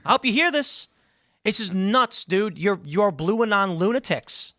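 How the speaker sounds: indignant call-out. Real, not acted. Host telling an absent opponent he's nuts, indignant call-out. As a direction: pitch it up and call them out.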